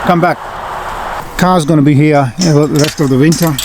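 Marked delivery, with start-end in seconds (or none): none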